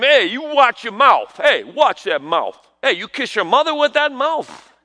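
hammed-up New York telling-off; drawn-out, punchy mock scolding; mock scolding elder. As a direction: imitate an exasperated New York parent. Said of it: "you'd hear it all the time".

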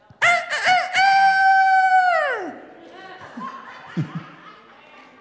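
loud, high cock-a-doodle-doo imitation; full-throated imitated rooster crowing. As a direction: crow like a rooster, long last note. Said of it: "That was a rooster".

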